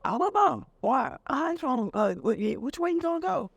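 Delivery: high-pitched, halting back-and-forth; wary exchange. Acted. Biker and prying local at gas station, wary exchange.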